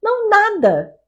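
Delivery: agudo e alto, voz de garoto desdenhoso; menino debochado imitado. Retold or imitated. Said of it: "Aí ele falou assim". (imitando o garoto debochado no carro)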